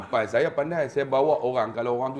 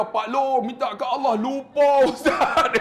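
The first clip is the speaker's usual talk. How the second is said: high, loud, breaking into laughter; laughing correction; 10 semitones higher, 6 dB louder, slower than usual. Retold or imitated. Ustaz telling pilgrim to ask God instead, laughing. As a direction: correct him loudly, cracking up by the end.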